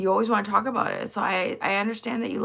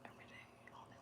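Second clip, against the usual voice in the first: whisper; 32 dB quieter, much faster than usual. Retold or imitated. Mocking ex.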